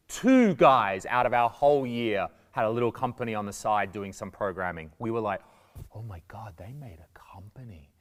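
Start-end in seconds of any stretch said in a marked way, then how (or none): 5.9-7.9 hushed mock amazement; awed whisper. Classmates marvelling at a student company, awed whisper.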